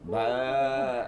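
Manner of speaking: low bleat of a fat sheep; goat bleat. Retold or imitated. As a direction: bleat deep and heavy. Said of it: "वो ऐसे होगा".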